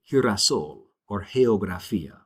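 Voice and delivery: girasol and geografía starting with an H sound; soft G sound. Shown as a demonstration. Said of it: "it's a soft sound".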